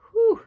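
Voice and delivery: big high exhale, blowing off heavy energy; sharp loud whew to clear the air. Real, not acted.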